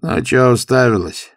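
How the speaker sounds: rough, loud demand; gruff. A narrator's reading. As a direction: snap at the staring girl. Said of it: "вдруг грубо спросил он".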